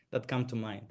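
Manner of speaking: normal talking voice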